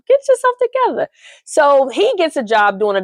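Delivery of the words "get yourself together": very high fast exasperated scold. Retold or imitated. Host telling Dre off, high exasperated. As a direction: snap at him to get it together.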